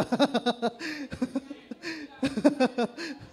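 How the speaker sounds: long high rising laugh; burst of laughter. Real, not acted. Preacher laughing at proud former drinkers.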